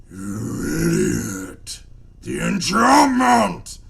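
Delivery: huge booming monster bellow; thundering voice. Acted. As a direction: roar it like an angry sea beast. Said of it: "a deep thundering voice".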